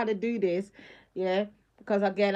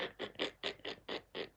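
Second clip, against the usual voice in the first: silent laugh; 16 dB quieter than usual. Real, not acted. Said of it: "I really can't stop laughing".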